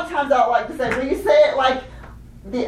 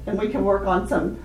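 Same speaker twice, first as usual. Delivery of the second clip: ordinary delivery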